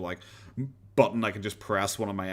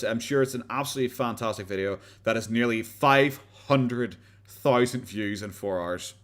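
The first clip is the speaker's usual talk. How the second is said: usual speaking voice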